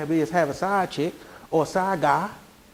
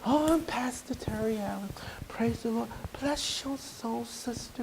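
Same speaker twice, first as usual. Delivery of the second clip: mock an overly holy pastor; hushed, syrupy pastor welcome; pious pastor; 14 dB quieter than usual. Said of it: "I didn't come to 'em like".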